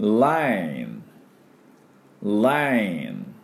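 slow model "lane" for レーン, long vowel gliding down; 発音のお手本. Shown as a demonstration. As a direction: say レーン as English lane for repeating. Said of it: "私の後について発音いきましょ". (レーン を英語の lane でゆっくり手本として)

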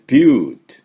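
ビュート as a loud, long "byoot"; ビュート with falling pitch, no final "o"; English "-bute" sound. Shown as a demonstration. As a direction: stretch the "yoo" in ビュート and end on a light t. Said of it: "で、えー".

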